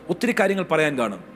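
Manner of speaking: ordinary delivery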